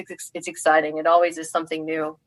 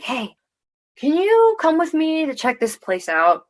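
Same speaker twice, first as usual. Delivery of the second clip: lightly imitated coaxing, pitched up; coaxing request; 10 semitones higher than usual. Fiance asks her along to check a place out, coaxing.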